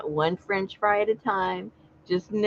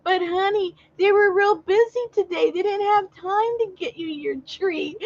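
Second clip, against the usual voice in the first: high, sweet coaxing voice to a sulking dog; sing-song baby talk to a pet; owner soothing her dog; 13 semitones higher than usual. Retold or imitated. Owner coaxing her sulking dog in the car, high sweet voice. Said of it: "And she goes".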